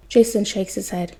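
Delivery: plain reading voice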